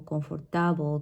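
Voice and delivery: comfortable with a full TA vowel, as com-for-TA-bul; misplaced stress. Shown as a demonstration. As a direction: stress "comfortable" late, like a learner. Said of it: "students are gonna pronounce it like".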